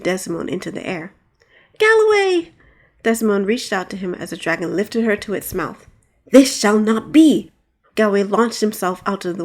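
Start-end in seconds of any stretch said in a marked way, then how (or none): 1.8-2.5 high frantic scream of his name; desperate cry. Acted. Princess carried off. 6.3-7.5 slow, loud heroic declaration; heroic vow. Acted. Knight leaps from window.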